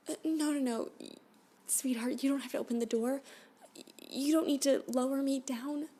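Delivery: high, loud frantic begging; desperate pleading. Acted. Captive begs the alien not to open the door, frantic and loud. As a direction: beg him not to let her go.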